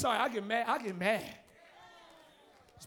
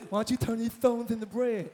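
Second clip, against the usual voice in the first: devil's voice; 4 semitones lower, 3 dB quieter than usual. Retold or imitated. Devil tempting Jesus.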